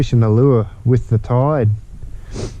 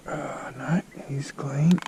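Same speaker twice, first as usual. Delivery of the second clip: soft unvoiced whisper; 2 semitones higher, 11 dB quieter, slower than usual. Real, not acted. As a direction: breathe the words out, barely audible. Checking fish at dawn, quietly.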